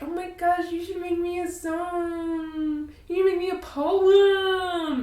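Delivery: high, eager gushing plea; squealing, begging for a poem; gushing Elektra. Retold or imitated. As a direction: beg like an excited fan. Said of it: "Elektra was like".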